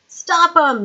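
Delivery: high, loud panicked yell. Acted. Lord Winder ordering guards to stop trumpeters, panicked yell. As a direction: scream it and duck. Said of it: "yelled Winder".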